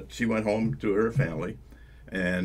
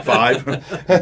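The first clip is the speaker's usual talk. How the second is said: embarrassed laugh, higher; 7 semitones higher, 9 dB louder than usual. Real, not acted. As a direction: laugh sheepishly through the number. Embarrassed admission of five marriages.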